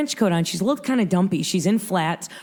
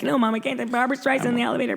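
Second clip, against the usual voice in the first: frantic protest; 5 semitones higher, much faster than usual. Retold or imitated. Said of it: "I'm like".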